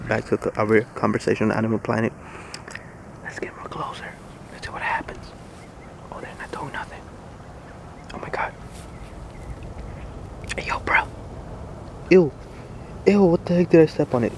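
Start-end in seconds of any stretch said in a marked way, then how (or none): 3.2-11.0 hushed mock-documentary whisper; stealthy whisper. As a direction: whisper while sneaking up on the animals. Creeping closer to wild ducks.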